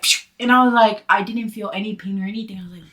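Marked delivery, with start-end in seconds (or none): 0.0-0.3 quick, loud "psh" noise; imitated stick slip. Showing friends how the stick went in, imitated whoosh. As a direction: imitate a sudden slide with a sharp psh. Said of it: "it went just like".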